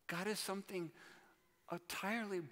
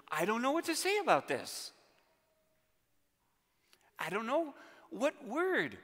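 louder, higher, driving sermon voice; fervent preaching; 5 semitones higher, 8 dB louder than usual. Acted. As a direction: preach it out with rising urgency. Preacher builds to full force.

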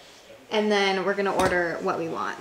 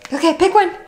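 pet talk; 8 semitones higher, 10 dB louder, faster than usual. Real, not acted. Talking to her dogs.

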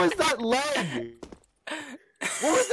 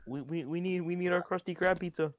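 put-on customer voice; 8 semitones lower, 8 dB quieter than usual. Customer voice ordering pizza.

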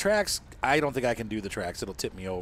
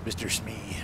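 whispering; 3 semitones lower, 10 dB quieter, slower than usual. Pretend. Whispered pirate aside.

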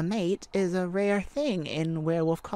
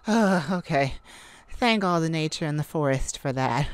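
ordinary talk

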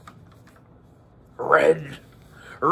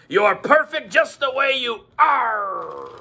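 pirate voice; 4 semitones higher, slower than usual. Acted. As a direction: bellow it like a pirate. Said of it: "my pirate voice".

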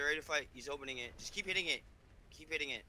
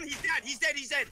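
excited shout; 9 semitones higher, 5 dB louder than usual. Real, not acted. Kill during firefight.

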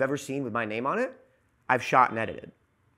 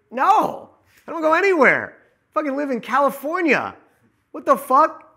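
much higher, louder indignant refusal; worked-up rant; 12 semitones higher, 6 dB louder than usual. Real, not acted. Editor refusing travel invitations, high loud indignant rant.

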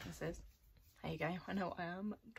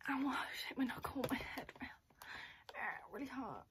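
whispered pain; 4 semitones higher, 4 dB quieter than usual. Real, not acted. Hit her knuckle on her head.

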